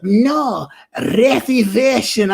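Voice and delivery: slow, high reservation with a lisp; mocking the bouncer. Friend mimics the bouncer's slow reservation, high and lispy. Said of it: "And he's like".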